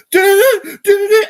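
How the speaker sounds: very high, loud imitated ad music; mocking, piercingly high musical stings; mock awful music. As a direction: shriek out a frantic jingle. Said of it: "they play this awful music".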